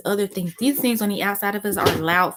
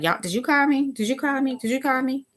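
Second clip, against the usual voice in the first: higher, repeated questioning call; calling round the house; 6 semitones higher than usual. Retold or imitated. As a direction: ask the household, one quick query after another. Acts out asking round the house.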